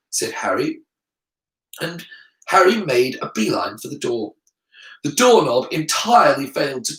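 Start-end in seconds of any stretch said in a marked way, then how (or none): none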